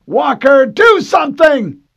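very high, loud desperate yell; desperate shout. A narrator's reading. Harlan yelling at Walker to fire the missiles. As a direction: scream it in panic.

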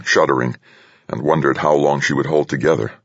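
plain reading voice

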